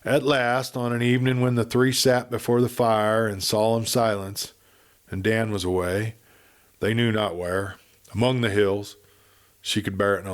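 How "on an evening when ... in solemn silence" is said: ordinary delivery, nothing marked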